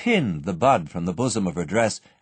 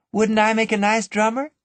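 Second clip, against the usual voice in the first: put-on high, light, teasing girl's voice; playful young woman; 12 semitones higher, 3 dB louder than usual. A narrator's reading. Annabel teasing her sweetheart, high and playful. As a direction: show off the hat and bag with a giggle in the voice. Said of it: "said Annabel".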